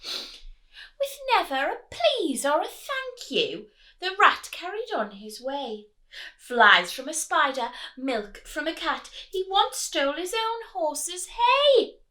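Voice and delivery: lively rhythmic read-aloud; picture-book rhyme reading; storybook narration. Acted. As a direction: read it bouncing along for little ones. Reading rhyming story to children.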